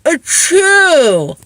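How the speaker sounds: big gasp then a loud achoo; loud fake sneeze. Shown as a demonstration. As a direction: sneeze into your sleeve for show. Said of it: "Watch this".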